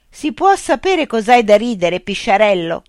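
playful taunt; teasing jab. A narrator's reading. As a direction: needle him with the nickname. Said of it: "chiese Altamarea".

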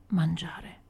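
murmured very softly, half to himself; a tired father murmuring softly. A narrator's reading. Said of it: "mormorò Bernardo".

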